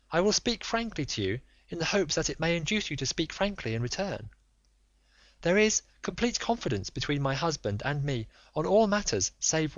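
high, urgent, imploring lady; a proud wife begging for the truth; pleading noblewoman. Acted.

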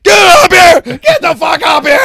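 high, loud panicked shouting; frantic shout from the burning hill; frantic yell. Retold or imitated. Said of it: "He's like".

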